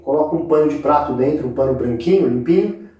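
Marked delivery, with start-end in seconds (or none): none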